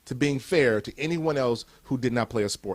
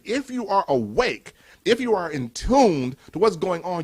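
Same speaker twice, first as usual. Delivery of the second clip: normal talking voice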